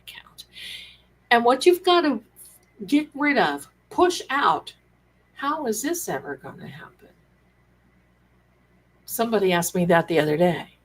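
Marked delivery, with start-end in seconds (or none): 0.0-0.4 breathy whisper, almost no voice; hushed confiding whisper; whispered secret. Pretend. Secretive lowered aside. 5.3-6.9 fast unvoiced whisper; anxious inner-voice whisper; doubting whisper. Doubting thought to push out.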